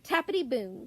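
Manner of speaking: plain reading voice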